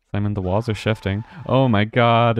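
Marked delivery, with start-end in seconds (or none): 1.5-2.4 startled. Real, not acted. Jump scare startles him.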